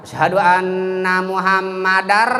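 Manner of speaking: chanted call ending "Muhammadar" on a rolled R; wrong trilled ending. Shown as a demonstration. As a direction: let the R ring at the end of "Muhammadar". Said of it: "salah itu". ("Muhammadar" dengan r akhir bergetar)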